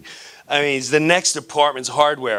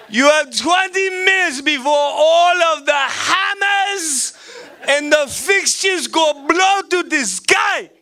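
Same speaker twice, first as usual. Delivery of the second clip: over-the-top Gaddafi. Pretend. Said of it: "I'm this over the top".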